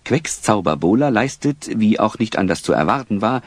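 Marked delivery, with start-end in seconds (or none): none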